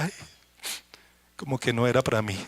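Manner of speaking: a hint of quiet discouragement; doubting inner voice. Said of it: "uno empieza a pensar".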